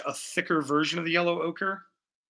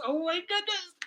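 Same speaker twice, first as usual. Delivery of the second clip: high, squealing oh my goodness; delighted surprise; 6 dB quieter than usual. Real, not acted. Streamer squeals as the chat makes friends. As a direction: squeal it in a much higher voice. Reacts to viewers becoming friends.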